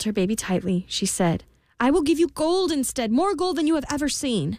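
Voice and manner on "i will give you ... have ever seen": high-pitched, pleading queen; desperate bargaining. A narrator's reading. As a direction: bargain urgently for the baby. Queen bargains desperately for her baby.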